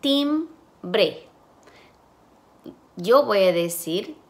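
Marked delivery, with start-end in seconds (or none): 0.0-1.3 timbre split as "tim... bre", pitched higher; syllable by syllable. Shown as a demonstration. Teacher modelling segmentation for students, syllable by syllable. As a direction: break timbre into two separate syllables. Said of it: "después dicen".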